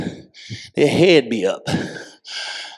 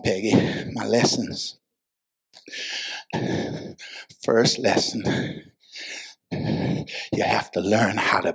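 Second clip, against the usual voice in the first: hushed, meditative preaching; 7 semitones lower, 11 dB quieter than usual. Real, not acted. Preacher sharing swimming lessons with congregation, hushed and meditative. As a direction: murmur it low, with long pauses.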